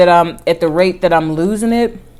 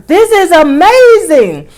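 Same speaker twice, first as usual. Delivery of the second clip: much higher, louder and slow, thrilled; amazed outburst; 11 semitones higher, 7 dB louder, slower than usual. Retold or imitated. Reacting to losing six pant sizes, thrilled and loud. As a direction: let the amazement burst out. Retelling shock at weight loss.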